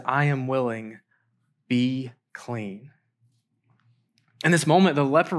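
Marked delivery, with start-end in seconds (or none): 0.1-2.9 low, soft, slow pronouncement; calm assurance. Acted. Jesus telling the leper he is healed, calm assurance. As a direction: say it gently, pausing before the softer command. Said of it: "And he says".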